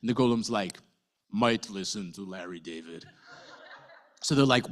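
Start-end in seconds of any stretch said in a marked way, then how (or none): 1.3-3.1 a lightly put-on blunt golem voice. Golem telling Len and Miri his plan, lightly voiced. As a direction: speak as a simple-minded giant golem, lightly voiced. Golem considers Larry David.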